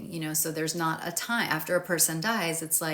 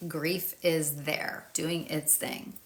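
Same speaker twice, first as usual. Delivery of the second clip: ordinary talk, nothing marked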